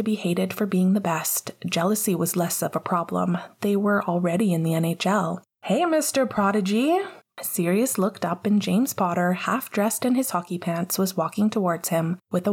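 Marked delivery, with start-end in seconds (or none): 5.6-7.0 high, excited call across the room. Acted. Teammate calling out to the new star, high and excited. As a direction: hail the new guy with a grin.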